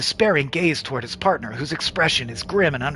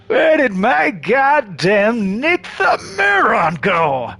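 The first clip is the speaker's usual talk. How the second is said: slow, higher furious demand; angry villain; 5 semitones higher, 4 dB louder, much slower than usual. Acted. Xanadu confronts the party, slow furious demand. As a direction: bite out each word in rage.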